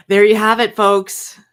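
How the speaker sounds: like a TV host closing the show; mock announcer.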